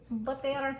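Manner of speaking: put-on playful low, soft Russian accent. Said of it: "I'm talking in a Russian accent".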